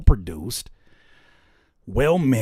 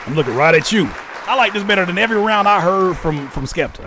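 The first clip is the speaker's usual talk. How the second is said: excited praise; 5 semitones higher, 7 dB louder than usual. Real, not acted. Hyping the song.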